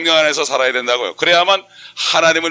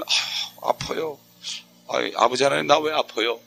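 pained whisper; 3 semitones lower, 6 dB quieter, slower than usual. Retold or imitated. Sick believer praying, voiced.

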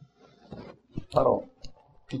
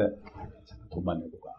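hushed, dismissive doctor; near-whispered false comfort; mock reassurance; 8 semitones lower, 17 dB quieter than usual. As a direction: imitate a doctor softly waving it off. Mimics doctor brushing off infected wound.

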